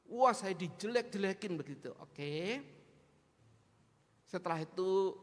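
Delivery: normal talking voice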